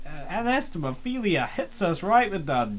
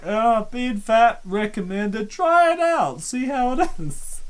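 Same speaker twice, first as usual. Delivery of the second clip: mock Valeria; 6 semitones higher than usual. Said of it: "She's really just like".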